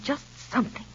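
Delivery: whispered mock plea. Mother mocking her daughter, in a whisper. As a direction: breathe it out, mimicking her.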